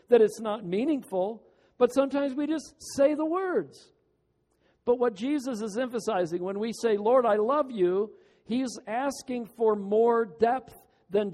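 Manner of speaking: fiery sermon climax; impassioned preaching. Acted. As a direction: plead with the congregation at full voice. Preacher at full force.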